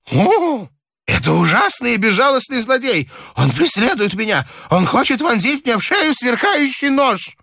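put-on high, hysterical old man's voice; frightened old man. A narrator's reading. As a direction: wail about the pursuer in terror.

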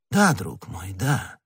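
soft, indulgent reassurance; fatherly. A narrator's reading. Cardinal soothing the prisoner he means to fool, soft fatherly tone. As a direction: speak gently, like a kindly father. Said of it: "произнёс кардинал отеческим тоном".

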